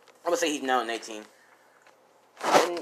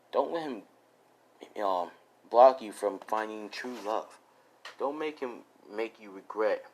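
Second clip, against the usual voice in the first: soft, gentle, comforting voice; lightly voiced tender comfort for a sad friend; tender reassurance; 4 semitones lower, 7 dB quieter than usual. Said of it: "Jake's like".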